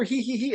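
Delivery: high, soft tittering hee hee; mock tittering. Podcaster mimics a giddy whispered tittering. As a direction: titter like a kid sharing a secret. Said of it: "jabbing him in the shoulder".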